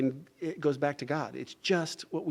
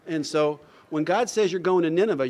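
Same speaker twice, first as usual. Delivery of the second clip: usual speaking voice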